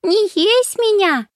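put-on squealing high child's voice, slow; a little girl laughing and squealing; giggling child. A narrator's reading. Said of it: "хохотала Даша".

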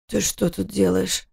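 slow, hoarse just-woken question. A narrator's reading. Husband wakes and asks his wife hoarsely. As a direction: croak it out, barely awake. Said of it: "спросил он хриплым голосом".

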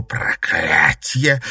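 slow hissed curse. Acted. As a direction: hiss the curse through rage. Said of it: "прошипела Кристина".

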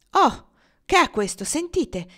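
high, sudden alert; startled exclamation. A narrator's reading. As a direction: alert him to a sudden noise. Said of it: "esclamò col dito in alto". (esclamazione di allarme, voce acuta)

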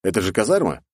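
plain reading voice

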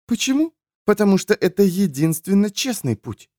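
high-voiced, lecturing woman; preachy woman character, instructive. A narrator's reading. Woman reminds companion of holiness school teachings, lecturing. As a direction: recite doctrine in a high, insistent voice.

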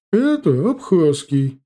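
put-on high, loud old trader's voice; creaky old woman voice. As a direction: answer like a loud old market granny. Old vendor repeats origin.

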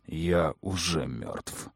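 low, slow bitter snap; snapped. A narrator's reading. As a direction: spit it out bitterly. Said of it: "огрызнулся он".

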